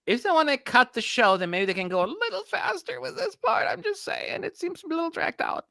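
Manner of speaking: high, passive-aggressive whine; I'm just saying, in a high mocking tone; mock sarcastic voice. Sarcastic complaint, high put-on voice.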